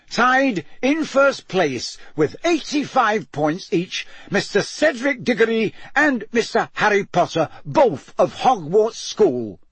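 high, ringing master of ceremonies; magnified announcer. A narrator's reading. Bagman announces the standings to the stands, magnified announcer voice. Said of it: "his magically magnified voice echoed".

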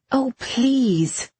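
high, impatient cut-in; exasperated interruption. A narrator's reading. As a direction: break in, fed up with the nonsense. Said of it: "Irene cut in".

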